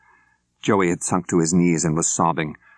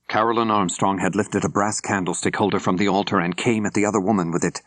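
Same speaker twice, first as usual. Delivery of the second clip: ordinary delivery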